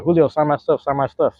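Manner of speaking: mock a needy adult fan; pushy grown fan. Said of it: "grown man got the nerve to be like".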